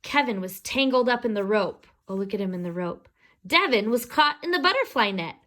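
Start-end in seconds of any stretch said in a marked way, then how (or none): none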